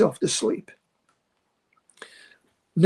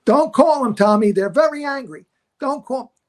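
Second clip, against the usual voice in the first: high urgent pleading, don't call; frantic agent on the phone; panicked agent; 6 semitones higher, 3 dB louder than usual. Retold or imitated. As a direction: beg him not to phone, voice jumping up.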